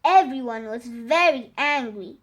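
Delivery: usual speaking voice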